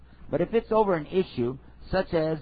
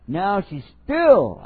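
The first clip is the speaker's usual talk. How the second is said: drawn-out emphasis; 4 semitones higher, 8 dB louder, much slower than usual. Shown as a demonstration. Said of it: "stretched for emphasis".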